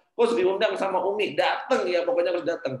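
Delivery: mock insistent phone caller; insistent urging. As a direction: press the invitation, pitched well up. Acted caller urging him to come.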